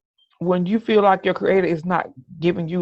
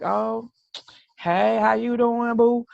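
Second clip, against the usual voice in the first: sweet greeting; 5 semitones higher, slower than usual. Retold or imitated. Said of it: "some days it's like".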